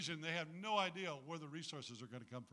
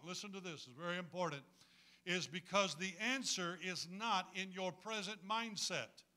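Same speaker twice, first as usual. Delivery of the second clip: normal talking voice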